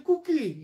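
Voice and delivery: কুকিল as "koo-kil"; slow কুকিল with u in the first syllable; regional u for o. Retold or imitated. Teacher mimicking local dialect for a laugh, o turned to u. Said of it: "কোকিলকে বলে কুকিল".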